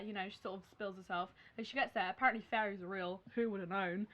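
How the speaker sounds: usual speaking voice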